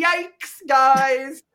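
high, loud drawn-out yikes; mock dismay. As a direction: wince it out high and long.

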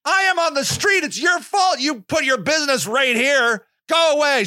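high-pitched, defiant retelling; indignant comeback. Retold or imitated. Activist retelling a sidewalk row over hurting business, indignant. Retold argument with office lady.

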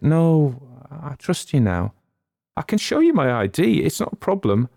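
a hint of a fragile young woman opening up; Molly, tearful young woman. A narrator's reading. Molly voiced higher.

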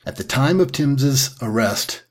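ordinary talk, nothing marked

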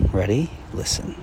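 soft, quiet guiding voice; guided exercise. Acted. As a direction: lead them gently into listening. Leads a listening exercise.

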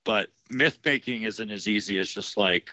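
ordinary talk, nothing marked

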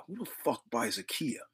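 whispered, in disbelief; a scandalized whispered aside; incredulous whisper. Acted. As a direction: hiss it under your breath like it's absurd. Disbelief at Kia ranking.

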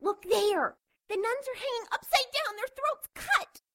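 high, horrified nun voice; frightened cry, held back; contained shout. Acted.

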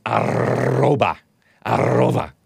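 "arroba" with a long trilled R; Spanish rolled R. Shown as a demonstration. Showing the Spanish at sign, "arroba" with trilled R. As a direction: roll the R hard in "arroba". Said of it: "The at sign in Spanish is".

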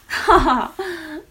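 short put-on "ha ha"; mock laugh. Psychic laughs at her own joke to clients, put-on chuckle. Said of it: "I merely joke".

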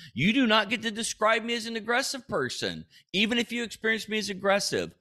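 high-pitched, indignant protest; heated self-defence. Acted. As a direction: insist hotly, pitch held high. Mock-heated defence against being called angry.

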